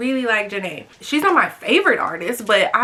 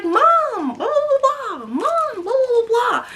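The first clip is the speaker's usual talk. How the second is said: put-on high whiny teen voice calling mom; mock high-pitched daughter nagging; imitating Vanessa; 12 semitones higher than usual. Daughter pestering her play mom, high whiny voice. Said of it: "so she'd be like".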